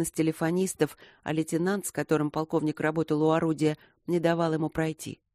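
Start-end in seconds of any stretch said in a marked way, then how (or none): none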